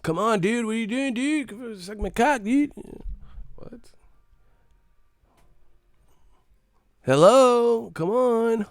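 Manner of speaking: squeaky high mocking call. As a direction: pitch way up to mock them. Mock high voice calling.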